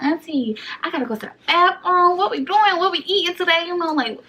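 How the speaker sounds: high, loud, rushed change of subject; panicked distraction. Retold or imitated. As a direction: drown out the auntie's question.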